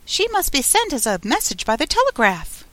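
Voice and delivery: high-pitched passenger voice; another passenger. A narrator's reading. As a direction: chime in high as another traveller. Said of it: "other voices went on".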